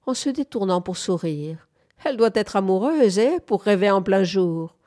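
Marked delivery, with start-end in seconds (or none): none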